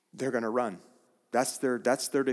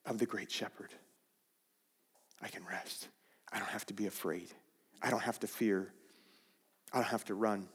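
hushed assurance; 4 semitones lower, 10 dB quieter, faster than usual. Real, not acted. Quiet assurance of no fear.

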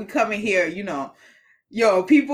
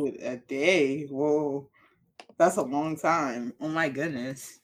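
mock shock; 8 semitones lower, 6 dB quieter, slower than usual.

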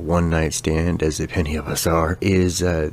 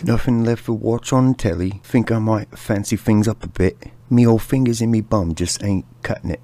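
mock British chap; 6 semitones higher than usual. Voicing the British bomber's thoughts.

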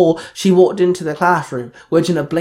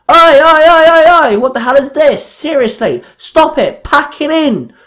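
loud, high-pitched shouting to break up a fight; urgent, angry yelling at full voice; barked shout; 11 semitones higher, 8 dB louder than usual. A narrator's reading. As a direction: bellow over a crowd to stop them. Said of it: "Alyssa barked".

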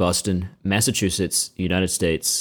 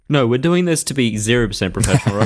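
usual speaking voice

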